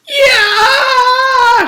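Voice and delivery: very high, loud scream; girl screaming as Paul grabs her other pigtail; scream of pain. Acted. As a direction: shriek as the pigtail is yanked. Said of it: "she screamed".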